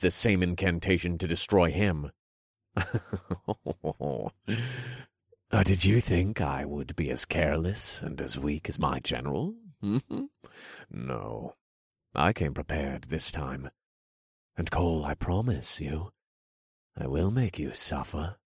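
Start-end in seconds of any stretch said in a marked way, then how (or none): 2.8-5.0 villain chuckle. Acted. King laughs at failed spell. 5.5-18.4 sly villain king. Acted. King voiced in story.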